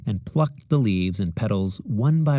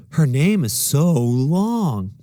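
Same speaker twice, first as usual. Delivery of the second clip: put-on higher, louder, slow sneering child; mocking classmate; 5 semitones higher, 5 dB louder, much slower than usual. A narrator's reading. Classmate mocking the long name to the teacher, drawn out. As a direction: drag it out to mock her.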